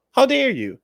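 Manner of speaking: high, loud snapped how dare you; mock outraged high voice. Mocking the offended partner, high and loud. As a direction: bark it like a hammed-up diva.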